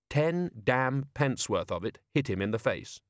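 plain reading voice

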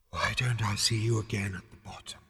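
soft, ghostly farewell; fading ethereal voice. A narrator's reading. Voice taking leave of Zaphod, fading. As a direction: drift away as you speak. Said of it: "it faded".

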